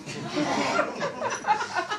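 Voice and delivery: high giggling laugh; amused laugh. Real, not acted. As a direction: chuckle at the joke. Narrator laughs.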